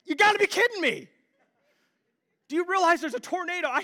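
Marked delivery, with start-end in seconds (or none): 0.0-1.1 high, disbelieving cry; can't believe she's asleep; exasperated disbelief; incredulous. Retold or imitated. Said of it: "I was like".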